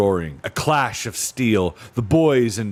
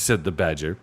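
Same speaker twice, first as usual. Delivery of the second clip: ordinary delivery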